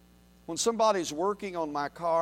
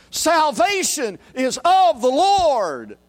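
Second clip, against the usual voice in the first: slow, much higher, louder shout; heavenly multitude shout; 9 semitones higher, 10 dB louder, slower than usual. Retold or imitated. Multitude in heaven shouting in unison. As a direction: proclaim it like a crowd in heaven. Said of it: "shouted together in unison".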